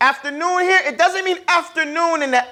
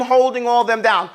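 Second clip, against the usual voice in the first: ordinary delivery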